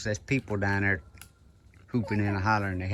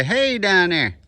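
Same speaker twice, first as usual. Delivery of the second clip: calling down from tower; 6 semitones higher, 6 dB louder, slower than usual. Shown as a demonstration. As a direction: call it down loud from up high.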